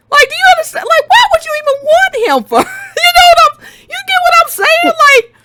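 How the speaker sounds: high, loud exasperated yell; real frustrated high shout; exasperated outburst. Real, not acted. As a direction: shout it in disbelief at the screen.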